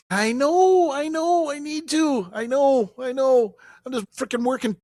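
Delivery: very high, loud put-on voice; hammed-up high-pitched excuses; mock pleading. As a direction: playfully protest way up high. Playful excuses to teasing traffic reporter.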